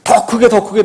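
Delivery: mimicked escalation in loud prayer. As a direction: push the volume as if outdoing others.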